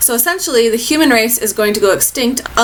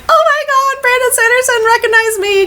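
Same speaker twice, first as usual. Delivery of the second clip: squealed much higher, a mock star-struck shout; fangirl yell; 3 dB louder than usual. Fan calling out to Brandon Sanderson, squealing high. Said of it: "'cause I was yelling at him".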